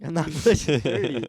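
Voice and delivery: loud, high-pitched denial; indignant protest. Real, not acted. Denying she's nearly thirty to her teasing friend, loud and indignant. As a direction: protest it loudly, offended.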